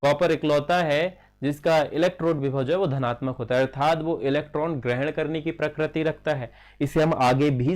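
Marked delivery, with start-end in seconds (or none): none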